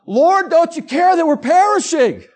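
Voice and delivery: high, loud, frantic cry to God in a bumpy plane; a nervous flyer's hammed-up panic; panicked prayer. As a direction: beg like the plane is going down. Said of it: "and I'm".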